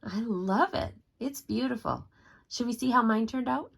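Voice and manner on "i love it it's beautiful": ordinary delivery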